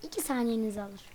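ordinary talk

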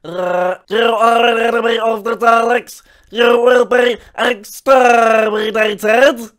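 slow, loud robotic Dalek. Acted. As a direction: grind it out like a Dalek. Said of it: "you wanna hear the Dalek impression".

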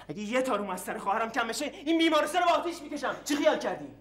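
raging threat against the hospital; angry threat. Acted. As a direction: threaten to burn the place down. Man threatens to burn hospital.

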